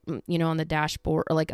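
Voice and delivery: ordinary delivery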